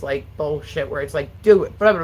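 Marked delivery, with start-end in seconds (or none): 1.4-2.0 a loud, higher bark of a nagging boss, trailing into blah; hammed-up impatient boss; imitating the boss who barks at her; mock bossy order. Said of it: "like, normal boss".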